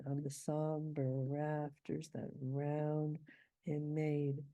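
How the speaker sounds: slow, with a pause before "him made"; slow scansion. Shown as a demonstration. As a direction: beat out "on the somber rafters". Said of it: "this line has two anapests".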